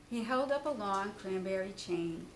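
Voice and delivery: usual speaking voice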